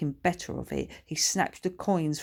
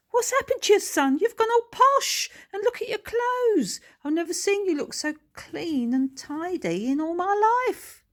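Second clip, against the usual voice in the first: much higher, louder working-class mum; shocked mother; 6 dB louder than usual. Acted. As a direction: squawk at him in disbelief. Mother shocked at posh son.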